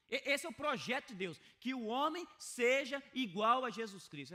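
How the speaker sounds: forceful preaching. Acted. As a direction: preach it out to the whole church.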